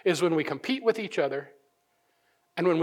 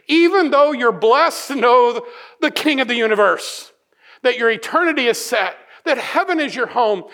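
raised preacher's voice, pressing each clause; emphatic preaching; 5 semitones higher, 7 dB louder than usual. Acted. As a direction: drive the point home to the congregation. Preaching at full force.

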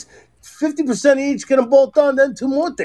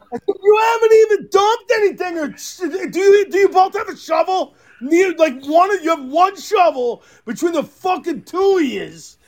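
high, incredulous rant; exasperated scolding; 5 semitones higher than usual. Retold or imitated. Husband to helpers with one shovel between them, exasperated scolding. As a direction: scold them in raised-pitch disbelief.

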